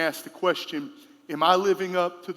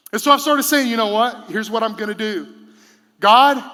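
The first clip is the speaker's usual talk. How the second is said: preaching louder; 3 semitones higher, 9 dB louder than usual. Real, not acted. Preacher to congregation, calling out loud. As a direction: build toward the cry of God. Building to prayer call.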